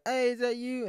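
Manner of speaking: high, loud eager hey; annoying guy running up, loud and eager; pushy stranger. Pretend. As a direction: call out like an overeager guy in public. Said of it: "being like".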